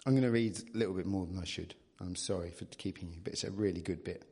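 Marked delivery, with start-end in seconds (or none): none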